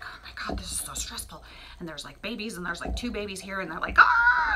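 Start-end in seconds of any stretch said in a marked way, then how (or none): 0.0-1.4 breathy, half-whispered mock panting; stressed mother cat. Mother cat having contractions, mock stressed and breathy. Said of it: "like, having contractions". 4.0-4.5 loud, high-pitched mock baby wail; imitated kitten scream. Newborn kittens yelling at their mother, imitated high scream. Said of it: "they're, like, screaming at her and yelling at her".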